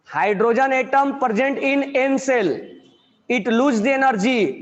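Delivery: words spaced out slowly for students to write; dictating a question to the class; slow dictation. Real, not acted.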